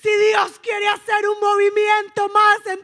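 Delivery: high, loud, fervent exhortation; building, shouted revival-sermon delivery; full-force preaching. Real, not acted. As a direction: drive each phrase home to the congregation.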